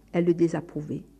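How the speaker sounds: ordinary delivery, nothing marked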